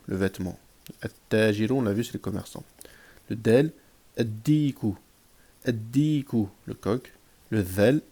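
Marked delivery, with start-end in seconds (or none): 4.2-6.5 "ad-diku" with a held double d, no L; doubled first letter. Shown as a demonstration. Teacher drilling sun-letter words, doubled d. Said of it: "on va doubler".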